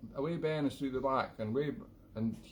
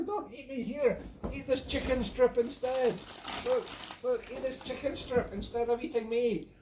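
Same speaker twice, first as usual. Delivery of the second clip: much higher, hammed-up terrified pleading; mock panic; 13 semitones higher than usual. Swimmer begging the Loch Ness Monster not to eat him, hammed-up panic. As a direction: squeal for your life, playfully. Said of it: "I'm attacked by a killer".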